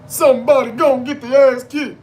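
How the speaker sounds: high, loud wrestling catchphrase; Mark Henry. Retold or imitated. Fan quoting Mark Henry's entrance theme, loud chanting. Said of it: "brought out Mark, Mark Henry".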